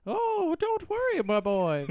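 loud, very high mock English lady; hammed-up falsetto English wife; wife's put-on accent. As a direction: warble reassurance in an absurd high English accent. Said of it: "if my wife had just went".

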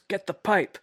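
high mock shout, lightly voiced tough guy; hammed-up wrestler yell; retold yell. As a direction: bark it like a brawler. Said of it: "Moxley yells".

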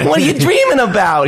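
changed character voice. Acted. Mattress asking the sleeper about dreams, high mock accent. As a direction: redo the creepy mattress as a high cartoonish character. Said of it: "you did change it".

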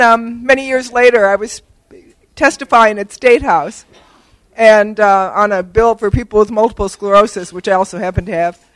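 normal talking voice

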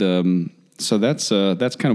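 ordinary delivery

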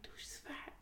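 unvoiced whisper, secretive. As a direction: whisper it so she won't hear.

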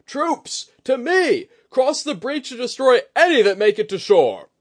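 loud, high battle command; rally the troops at a full shout; barked orders. Acted. Commander rallying troops to the breach, barking orders. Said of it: "Etsuji barked".

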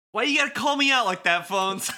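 high, quick whine at a teasing friend; mock-indignant complaint. Beat objects to Neku calling him out, mock-indignant complaint. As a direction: blurt it out fast, pitch way up. Acted complaint of teased game character.

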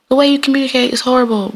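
high-pitched mimicry of a scolding cousin; mocking imitation. Podcaster replaying cousin's criticism of her communication, mockingly. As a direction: parody her complaint, pitched well up.

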